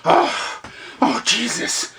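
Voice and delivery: big breathy sighs of imitated dismay; mock exasperation. Pinched customer reacts to the clerk's joke, exasperated mock sighing.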